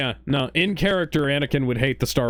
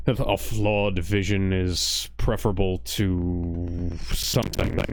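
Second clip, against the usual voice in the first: Vader voice; 7 semitones lower, slower than usual. Retold or imitated. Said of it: "Vader came up with".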